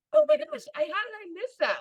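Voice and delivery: squealing "oh my gosh", very high then dropping; shocked she missed the concert; excited gasp. Retold or imitated. Retold finding the concert late.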